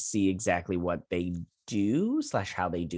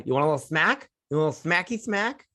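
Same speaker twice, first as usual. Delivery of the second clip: high, rising teasing offer; mock-threaten like a cutesy parent; playful threat; 7 semitones higher, faster than usual. Viewer offering the bickering brothers a smack, playful threat.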